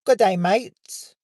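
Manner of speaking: high, drawn-out attempt at a New Zealand accent. Host obliging a request, hammy New Zealand accent attempt. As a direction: try a Kiwi accent, landing closer to Australian. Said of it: "do a New Zealand accent".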